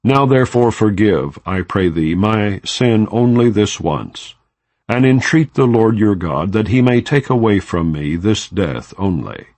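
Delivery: ordinary talk, nothing marked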